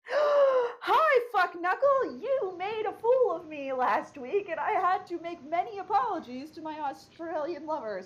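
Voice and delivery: high, gushing reproach after a gasp; mock-sweet scolding. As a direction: put on a high, theatrical telling-off. Mock gushing address to a question asker.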